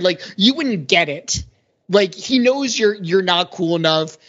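ordinary talk

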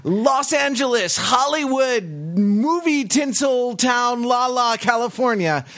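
high, breathless awards-night host; gushing red carpet chatter. Pretend. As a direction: gush like a live red carpet reporter. Mock red carpet broadcast opening.

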